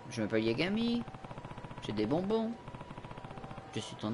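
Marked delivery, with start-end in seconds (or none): none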